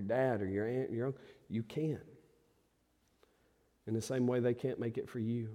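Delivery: a preacher quietly pleading with each listener; soft intimate appeal. Acted. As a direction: draw them in softly.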